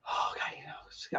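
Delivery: breathy whispered aside. Real, not acted. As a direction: drop to a whisper. Sudden aside while reading.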